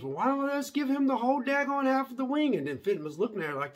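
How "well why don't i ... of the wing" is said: high-pitched, put-on retort; mock exasperated retort. Woman in the kitchen retorting about the wing, mock exasperated. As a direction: imitate her retort way up high.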